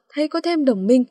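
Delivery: ordinary delivery, nothing marked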